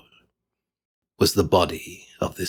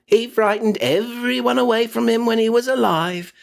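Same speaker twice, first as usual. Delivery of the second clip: put-on higher gloating old woman's voice; gloating charwoman; 13 semitones higher than usual. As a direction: crow over the spoils. Charwoman gloats over the money.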